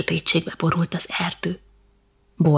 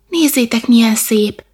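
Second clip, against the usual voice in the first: high, louder cry of admiration; narrated excited exclamation; exclaiming in wonder; 5 semitones higher, 6 dB louder than usual. A narrator's reading. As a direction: call the others to look. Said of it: "kiáltott fel ismét Borzi".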